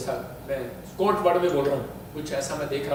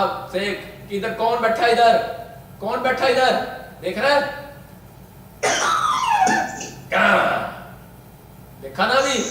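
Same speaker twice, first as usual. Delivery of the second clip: raised, insistent short questions; exorcist challenge; 6 semitones higher, 5 dB louder than usual. Real, not acted. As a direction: confront the spirit, pausing after each demand. Challenges the spirit to look. (आत्मा को कड़ी चुनौती)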